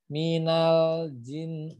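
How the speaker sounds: slow minal jin, lam not bounced; held lam. Shown as a demonstration. Teacher showing the unbounced lam. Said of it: "Tapi ditahan".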